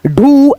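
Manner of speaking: "ढू" held long on the ऊ; slow demonstration of the long vowel in "ढू"; drawn-out long vowel. Shown as a demonstration. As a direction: stretch "ढू" slowly. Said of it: "थोड़ा सा खींचना होता है".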